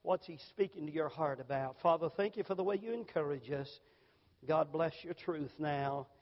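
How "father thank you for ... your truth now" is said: low, soft prayer voice. Acted. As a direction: pray quietly and reverently. Closing prayer.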